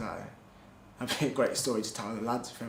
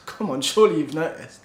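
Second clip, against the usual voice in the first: loud, raised mock exasperation; retold incredulity; 4 semitones higher, 9 dB louder than usual. Doctor answering a patient who hadn't noticed, loudly incredulous. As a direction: push back loudly, imitating a disbelieving doctor.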